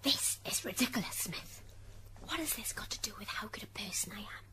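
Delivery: hushed, indignant whisper to counsel; whispered protest. Acted. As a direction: whisper it aside in court. Whispered asides during court hearing.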